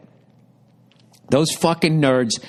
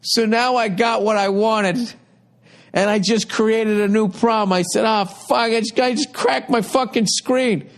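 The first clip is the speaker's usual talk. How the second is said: worked-up retelling, pitched way up throughout; high-pitched exasperation; 11 semitones higher than usual. Retold or imitated. Customer recounting the cracked-screen exchange with the clerk, exasperated. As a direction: rant it high, voicing both sides in exasperation.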